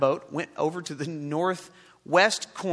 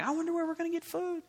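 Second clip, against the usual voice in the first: wondering disciples; 11 semitones higher, 6 dB quieter, faster than usual. Retold or imitated. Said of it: "and they're wondering".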